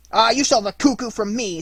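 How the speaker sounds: high, loud challenge; angry threat. A narrator's reading. Courageous boy before striking, loud and high. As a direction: spit the threat back. Boy about to hit Pinocchio.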